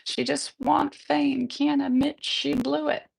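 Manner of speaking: light country twang, sung. Acted. Male chorus shrugs it off in a light country twang. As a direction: give it a mild Southern country twang. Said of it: "Male chorus in their country twang".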